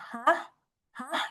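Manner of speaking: HAH rising like a puzzled question; questioning second tone. Shown as a demonstration. Teacher reviewing tone two, HAH said as a confused question. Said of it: "Kemudian ada dua".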